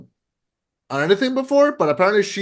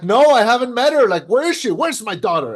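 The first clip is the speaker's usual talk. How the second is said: higher-pitched, animated questioning; acted-out puzzlement; 7 semitones higher than usual. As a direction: play a dad searching for his daughter. Acting out the actor looking around.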